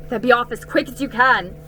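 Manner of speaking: loud, fast urging to hurry; an anxious fawn hurrying the girl away; urgent fawn. A narrator's reading. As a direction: send her off quickly, loudly. Tumnus urges Lucy away.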